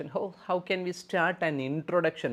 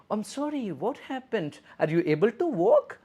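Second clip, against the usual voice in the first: much higher, concerned nurse voice; caring nurse intonation; 9 semitones higher, 5 dB louder than usual. Shown as a demonstration. Nurse asking a hurt patient gently, higher concerned tone. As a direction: ask with real concern in a lifted pitch. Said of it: "use your proper intonation".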